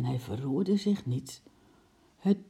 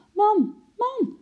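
high, loud call to wake the husband; excited, high-pitched exclamation; excited cry; 5 dB louder than usual. A narrator's reading. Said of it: "riep ze opgewonden".

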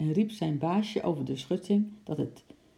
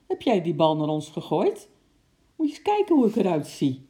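indignant accusation; 2 semitones higher, 6 dB louder than usual. A narrator's reading. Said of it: "riep hij".

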